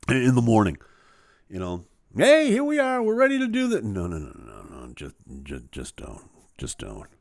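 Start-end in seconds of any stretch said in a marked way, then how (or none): 2.2-3.8 high, loud, bright-eyed eager helper; a much higher, louder eager assistant voice; chirpy assistant. Retold or imitated. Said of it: "my assistant will come over". 3.8-7.1 low, soft groaning no; a low, much softer uncaffeinated moan; grumpy pre-coffee refusal. Retold or imitated. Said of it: "do not talk to me".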